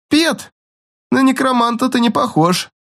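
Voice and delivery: high, sneering taunt. A narrator's reading. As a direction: jeer at him up high. Bully taunts the zombie.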